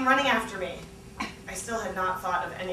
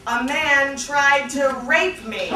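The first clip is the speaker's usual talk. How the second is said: dramatic claim; 5 semitones higher, 7 dB louder, much slower than usual. Acted. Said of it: "I said".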